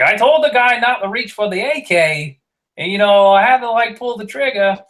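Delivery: high, thick Boston accent impression. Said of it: "Matt Damon Boston accent".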